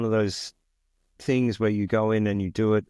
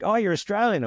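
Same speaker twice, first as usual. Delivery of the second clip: much higher, mock-surprised American voice; surprised American crew guy; 12 semitones higher than usual. Crew guy noticing the Aussie accent, mock surprise. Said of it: "And he's like".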